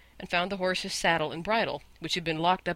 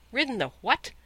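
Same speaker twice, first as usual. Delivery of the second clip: scornful, higher-pitched disbelief; incredulous disdain, pitched up on the question; contemptuous retort; 6 semitones higher, 3 dB louder than usual. A narrator's reading. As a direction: throw it back with extreme contempt. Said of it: "with extreme contempt".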